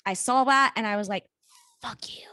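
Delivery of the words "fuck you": very soft, under-the-breath retort; retold hushed curse. Speaker grudgingly answering her own intuition, hushed curse. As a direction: curse softly, almost voiceless.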